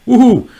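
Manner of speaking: high, loud whoop of joy; real woohoo; excited woohoo. Real, not acted. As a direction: let out a happy whoop. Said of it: "I got really excited there".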